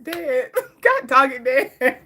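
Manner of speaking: laughing protest at dad. Real, not acted. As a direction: scold him through laughter.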